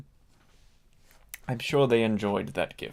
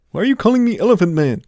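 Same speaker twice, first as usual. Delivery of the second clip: fast, high, loud mock outrage; mock indignant; 9 semitones higher, 9 dB louder, much faster than usual. Elephant guest objecting to the nickname, mock indignant. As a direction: snap back in playful offense.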